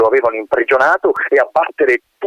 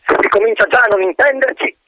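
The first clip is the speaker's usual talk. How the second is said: colonel grumbling; 12 semitones higher than usual. Acted.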